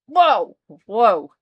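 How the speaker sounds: loud startled whoa. Real, not acted. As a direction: yelp it out in surprise. Startled game reaction.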